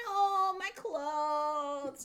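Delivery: put-on high, very slow toddler whine; stubborn toddler. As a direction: drag it out like a stubborn little kid. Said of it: "she goes".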